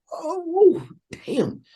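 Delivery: high, drawn-out oh, then stunned damn; retold wail of amazement; awestruck. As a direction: react floored by a great player. Awed on hearing [name] play.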